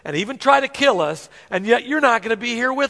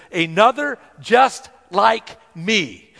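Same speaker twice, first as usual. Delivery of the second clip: "Another just like me" stretched out slowly; slow, weighted Jesus line; slow emphasis; 3 dB louder, much slower than usual. Shown as a demonstration. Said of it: "the another Jesus used here".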